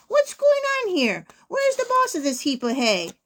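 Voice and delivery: very high, outraged question; hammed-up high indignation; screeched demand. A narrator's reading. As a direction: demand to see who's in charge. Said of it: "Gritch screeched".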